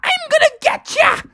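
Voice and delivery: very high cackling villain threat; evil skeleton. Acted. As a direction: taunt them in a high skeleton voice. Laughing skeleton gives chase.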